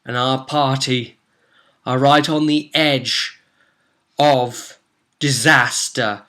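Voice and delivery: grand story narrator, slowing toward the end; dramatic recap narration. A narrator's reading. Recapping the cursed party's peril, dramatic narration. Game recap scene setting.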